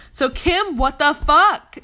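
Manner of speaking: loud, slow, drawn-out what the fuck; stretched-out exasperated yell; exasperated outburst. Real, not acted. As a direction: yell it at Kim in disbelief.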